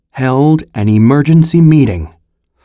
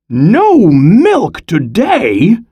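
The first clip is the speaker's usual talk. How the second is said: high, slow, stretched-out cry; high disbelieving cry; cry of disbelief; 7 semitones higher, much slower than usual. A narrator's reading. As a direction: Farmer Brown can't believe it. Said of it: "cried Farmer Brown".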